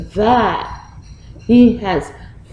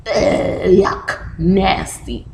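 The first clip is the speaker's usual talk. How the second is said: disgusted; slower than usual. Retold or imitated. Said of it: "I go".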